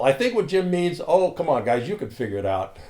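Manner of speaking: loud, high call that fades to teasing; mock yell across room. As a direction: shout it over, then laugh it off. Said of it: "eventually I'd just yell".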